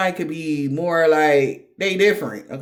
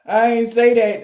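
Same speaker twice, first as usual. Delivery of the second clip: mock insistent denial; 5 semitones higher, 6 dB louder than usual. Repeats denial, punched out.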